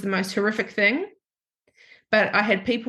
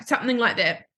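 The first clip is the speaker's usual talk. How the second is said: normal talking voice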